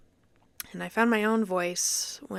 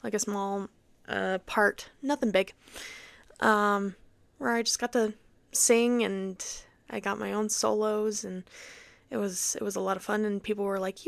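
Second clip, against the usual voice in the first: usual speaking voice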